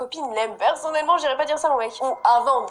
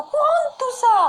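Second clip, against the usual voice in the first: exasperated shout; 13 semitones higher, 5 dB louder, slower than usual. Real, not acted. Scolding the character.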